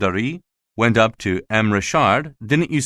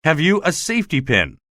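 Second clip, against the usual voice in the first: mild retold shout; 6 semitones higher than usual. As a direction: call it out urgently in a raised pitch. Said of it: "what he shouted at me".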